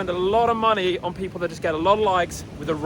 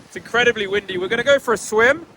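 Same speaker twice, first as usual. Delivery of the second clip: shouting over wind; 6 semitones higher than usual. Real, not acted. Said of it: "You might not be able to hear me over the wind".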